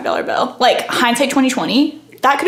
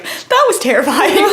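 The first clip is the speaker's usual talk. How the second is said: high, laughing that was terrifying; laughing at her own fright; laughing relief; 6 semitones higher, 4 dB louder than usual. Real, not acted. Laughing relief after the lady leaves.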